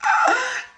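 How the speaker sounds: high sharp gasp of outrage; shocked gasp. Real, not acted. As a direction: suck in air, scandalised. Shocked at his demand.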